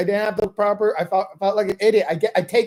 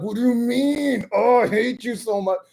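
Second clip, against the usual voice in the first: mock outraged whine; 3 semitones higher than usual. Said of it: "I'm like".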